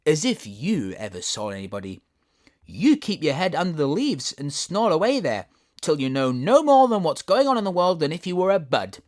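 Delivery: scolding lily. Acted. Tiger lily scolds violet.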